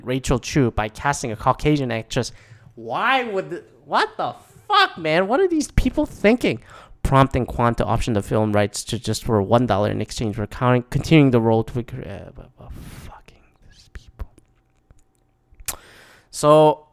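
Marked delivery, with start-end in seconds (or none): 2.7-6.6 very high-pitched, squeaky disbelief; incredulous outrage. Real, not acted. Outrage at whitewashing proposal. 11.8-14.3 whispered, fading grumble; barely audible exasperated whisper; muttered exasperation. Real, not acted. Muttered disgust while reading article.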